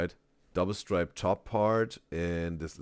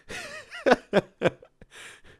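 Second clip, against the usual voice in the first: high, loud peals of laughter; big laugh; 6 dB louder than usual. Real, not acted. Reactor amused by the Joe Pesci likeness, high loud laughter. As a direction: fall about laughing at your own joke.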